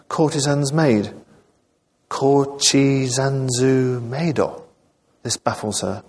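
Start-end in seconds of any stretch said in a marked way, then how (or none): 2.1-4.6 put-on slow, puzzled Japanese-accented echo; Japanese-accented English, vowels added at word ends. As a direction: sound out the foreign words slowly in a Japanese accent. Baffled foreigner echoes English phrase.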